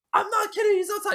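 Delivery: very high frantic voice; acted high-pitched desperation; panicked insistence. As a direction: plead shrilly to be believed. Victim pleads with doubting dispatcher.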